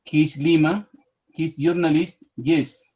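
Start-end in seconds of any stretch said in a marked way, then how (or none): none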